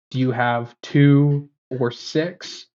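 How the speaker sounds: slow question with spaced-out options; slow, deliberate question. Retold or imitated. Customer clarifying strengths for confused clerk, slow and deliberate. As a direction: ask it very slowly so it lands.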